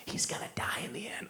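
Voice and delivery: a soft, unvoiced whisper; buddy whispering a spoiler. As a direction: whisper it like in a cinema.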